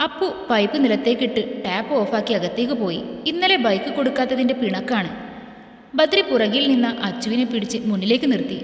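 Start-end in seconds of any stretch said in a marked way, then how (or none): none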